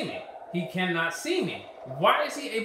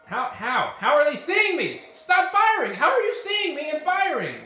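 high, loud yell; angry yelling; 6 semitones higher, 5 dB louder than usual. Real, not acted. Gamer yelling at enemies who spot him, angry yelling. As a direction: yell at the unseen shooters.

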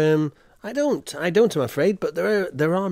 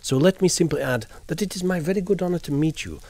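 usual speaking voice

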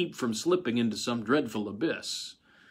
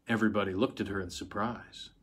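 ordinary delivery

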